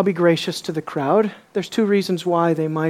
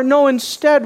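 ordinary delivery

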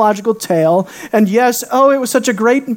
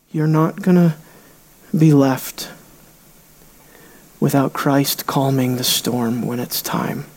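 tender reassurance; 8 semitones lower, 4 dB quieter than usual. Real, not acted. Preacher comforting anxious listeners, low and soft. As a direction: reassure gently.

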